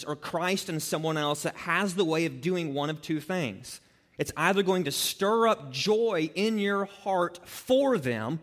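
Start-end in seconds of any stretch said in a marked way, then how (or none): none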